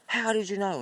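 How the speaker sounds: high-pitched, insistent nag; nagging. Acted. Wife pressing husband for his secret, nagging. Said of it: "And she nags him and nags him".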